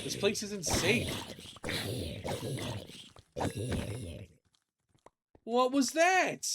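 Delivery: high, loud alarmed cry; startled by an attack. Real, not acted. Zombies attack suddenly.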